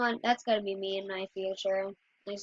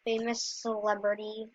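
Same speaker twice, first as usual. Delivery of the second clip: ordinary talk, nothing marked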